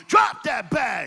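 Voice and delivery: slow, loud, drawn-out command; call-out shout. Shown as a demonstration. Preacher leading the congregation in a loud slow chant. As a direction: bark each word for the crowd to echo. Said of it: "every time I say it, you gotta say it with me".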